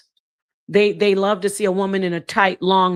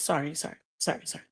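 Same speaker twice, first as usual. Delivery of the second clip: sheepish murmured apology; 4 semitones lower, 12 dB quieter, much faster than usual. Real, not acted. Apologizes under her breath.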